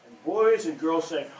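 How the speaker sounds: plain reading voice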